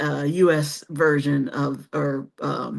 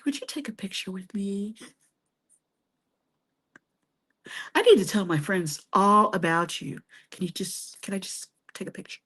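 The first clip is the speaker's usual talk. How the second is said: soft, fast, hushed mock-shy asking; a starstruck fan asking a Greek millionaire for a photo, hushed and hurried; 7 dB quieter, faster than usual. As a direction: whisper it like a starstruck fan trying to play it cool. Pretending to ask a millionaire for a photo.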